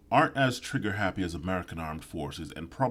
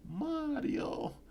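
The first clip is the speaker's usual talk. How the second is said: very high, softer cartoon Italian accent; mock Italian; 4 dB quieter than usual. Mocking Italians in a high cartoon Italian accent. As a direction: squeak out a cartoon Italian name.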